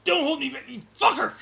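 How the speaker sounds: high, screaming hammed-up fury; mock rage. Streamer parodying a raging opponent, in mock screaming fury. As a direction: scream like you're being held back.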